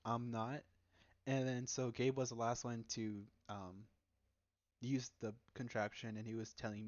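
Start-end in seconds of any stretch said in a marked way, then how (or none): none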